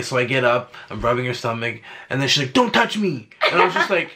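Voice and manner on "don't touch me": high-pitched mock snap; hammed-up, higher rebuff; imitated snapping. Partner mimicking her refusing his touch in labor, mock snapping.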